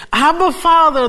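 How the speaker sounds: high, loud cry of Abba, Father. Acted. Preacher crying out Abba, Father as believers do. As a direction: cry it out to God.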